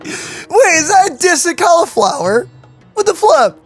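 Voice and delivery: high-pitched, incredulous reaction; amused disbelief. Real, not acted. Streamer's mock outrage at the pun.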